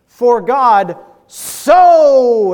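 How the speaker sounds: SO stretched very long and loud; hammed-up preacher booming a drawn-out SO; preacher's emphasis on SO. Shown as a demonstration. Mocking how preachers quote John three sixteen, over-emphatic SO. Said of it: "have you ever heard a preacher do that?".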